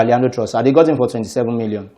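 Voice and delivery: ordinary talk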